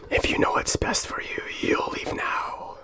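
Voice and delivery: low, breathy, menacing demon whisper; demonic whisper. Acted. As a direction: hiss it like a snaky demon. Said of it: "kinda like a snaky demon".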